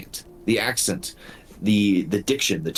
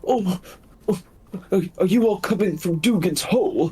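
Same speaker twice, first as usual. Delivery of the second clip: high, quick, whimpering wolf voice; a frightened injured wolf pleading; timid beast in a high voice; pitiful wolf; 9 semitones higher, faster than usual. Acted.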